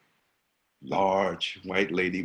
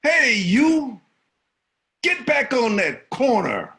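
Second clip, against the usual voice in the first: put-on high, loud order to a kid; yelling; 8 dB louder, slower than usual. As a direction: bark the crossing guard's command. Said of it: "I yelled at him".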